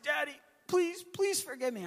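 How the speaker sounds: high-pitched imitation of a begging kid; mock childish begging; pleading child. Son begging father for forgiveness.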